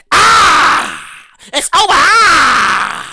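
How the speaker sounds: drawn-out, hammed-up yell; theatrical shout. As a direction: cry out the verdict, stretching it long. Theatrical end of the rant.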